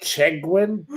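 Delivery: scornful question. Real, not acted. Scorning the troll's name.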